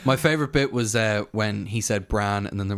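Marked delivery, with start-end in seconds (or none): none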